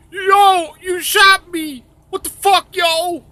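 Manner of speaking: high-pitched outraged cry; mock shot victim. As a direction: yelp it in a falsetto. Voicing a man just shot.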